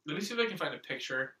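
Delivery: usual speaking voice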